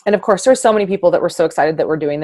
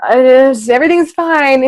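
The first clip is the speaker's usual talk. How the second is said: mock cheerful denial; 6 semitones higher, 6 dB louder than usual. Mocking forced denial, Joker syndrome.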